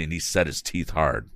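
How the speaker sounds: ordinary delivery, nothing marked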